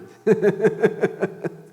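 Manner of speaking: short high laugh at himself; real laugh. Real, not acted. Preacher laughing at mixing up Isaí's name, a real laugh. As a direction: let a laugh break out.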